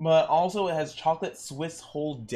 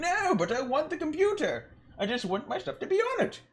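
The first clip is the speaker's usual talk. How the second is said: high whiny customer. Retold or imitated. Customer voiced in story.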